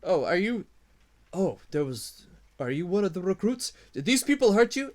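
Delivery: rising from gentle to high and loud; kobold to recruit. Acted. Kobold asking the wounded recruit if he was hurt, rising high. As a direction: coax the boy, then squeak in alarm. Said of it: "kobold addresses the recruit".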